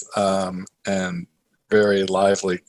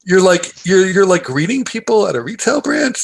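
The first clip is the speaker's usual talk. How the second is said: much higher, half-mocking disbelief; incredulous reaction; 11 semitones higher, 3 dB louder than usual. Nephew reacting to his uncle greeting customers, incredulous. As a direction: squeak out your shock at the founder greeting customers. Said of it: "I was almost like".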